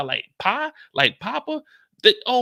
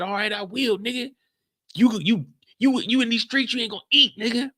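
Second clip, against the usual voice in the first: emotional threat; 9 semitones higher than usual. Acted. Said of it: "Kane's getting a little emotional".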